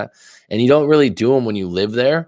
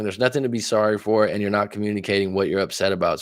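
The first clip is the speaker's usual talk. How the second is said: normal talking voice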